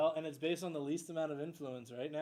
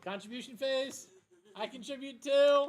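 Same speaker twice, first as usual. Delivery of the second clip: higher, louder game announcement; excited announcement; 10 semitones higher, 9 dB louder than usual. Real, not acted. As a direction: announce it with big energy.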